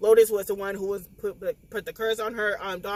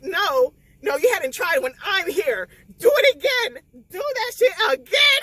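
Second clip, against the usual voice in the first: tearful demand; 7 semitones higher, 6 dB louder than usual. Retold or imitated. Lotus demanding doctors revive him.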